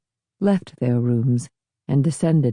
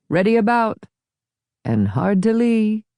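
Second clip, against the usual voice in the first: raised, calling out commands; sailing call; 8 semitones higher than usual. Acted. Host to his crew, calling out to tack. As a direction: call it across the boat.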